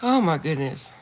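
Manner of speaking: normal talking voice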